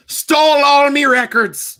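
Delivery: slow, loud, high pirate wail; pirate accent. Acted. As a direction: cry it out like a wronged pirate. Pirate voice, wronged.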